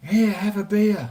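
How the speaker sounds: high-pitched voice of a mate; mock-keen offer, pitched well up; imitating eager host. Quoting the friend handing out beer.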